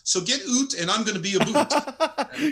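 slow, high mock Canadian, laughing; Canadian accent. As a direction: draw out oot and aboot Canadian style. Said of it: "he goes".

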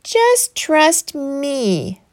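very slow, sly serpent whisper; snake voice. Acted. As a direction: draw the words out like a tempting snake. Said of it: "the serpent whispered".

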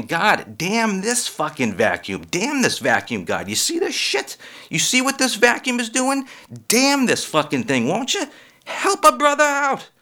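high, frustrated plea to the vacuum; exasperated pleading. Retold or imitated. Comic pleading with a vacuum, high exasperated whine. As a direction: beg the vacuum to cooperate. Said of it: "I'm saying".

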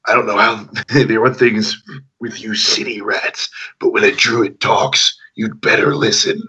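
gruff, intimidating druid. Acted. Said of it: "I had a druid voice".

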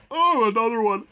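words stretched by a yawn; put-on yawn. Tired cohost warns he may do another one, yawning. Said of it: "so excuse me if I go".

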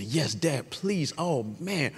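soft, small-voiced begging; imitated pleading. Dad imitates daughter begging to cheer, soft pleading. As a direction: plead softly, imitating an eager kid.

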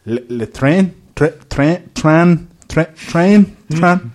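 repeated mock-French tries; bad French attempt. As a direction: grope for the French pronunciation, syllable by syllable. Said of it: "with French people now".

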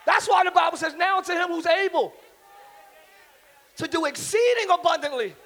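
loud, slow shouted preaching; shouted sermon; preaching at full force. Acted. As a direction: drive every word home to the crowd.

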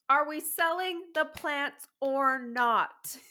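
slow, loud, fed-up question; exasperated demand. Real, not acted. Friend complains about Marketplace sellers, slow and exasperated. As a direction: drag it out in disbelief.

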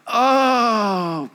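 hammed-up pitiful groan; mock moan. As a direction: groan for sympathy. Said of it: "I come into work on Fridays and I go".

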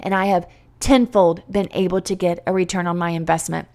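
ordinary delivery

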